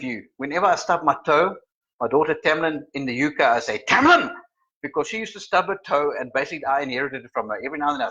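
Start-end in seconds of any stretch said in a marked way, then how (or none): 3.9-4.3 high, loud cry of a name; mock pained yell. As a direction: yelp the name as if you stubbed a toe. Calls daughter's name on stubbing toe.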